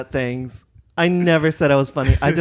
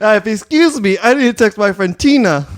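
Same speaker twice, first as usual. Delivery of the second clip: huffy diva; 5 semitones higher, 9 dB louder than usual. Retold or imitated. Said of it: "And I was like".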